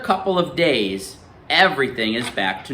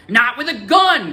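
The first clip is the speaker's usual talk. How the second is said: raised, higher pitched yell; 10 semitones higher, 3 dB louder than usual. Acted. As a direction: push back hard, a bit louder. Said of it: "Graham yells".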